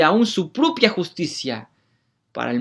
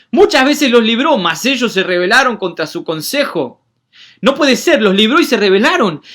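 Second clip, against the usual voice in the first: loud, high, fervent preaching; preaching at full force; 7 semitones higher, 6 dB louder than usual. Acted. Preacher reading the psalm to the church, fervent and loud. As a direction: proclaim it loudly to the congregation.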